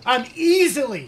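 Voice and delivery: "easily" drawn out, high and loud; stressed easily. Real, not acted. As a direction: lean hard and slow on "easily". Playful boast at friend.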